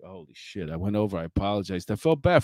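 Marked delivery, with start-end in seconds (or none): 0.0-0.7 stunned mutter. Retold or imitated. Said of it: "And I was like".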